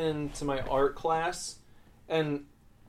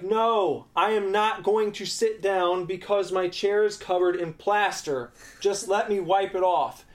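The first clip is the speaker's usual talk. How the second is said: raised, higher voice, arguing back; indignant retold protest; defiant retort; 6 semitones higher, 5 dB louder than usual. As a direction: refuse loudly, re-enacting the standoff. Retold defiant argument with teacher.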